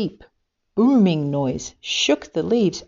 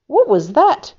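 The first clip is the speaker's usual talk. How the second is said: much higher, much louder panicked cry; terrified scream; 9 semitones higher, 8 dB louder than usual. Acted. Little Kiwi clinging to his mother, terrified scream. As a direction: scream it in fright at the eyes in the dark. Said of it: "screamed little Kiwi".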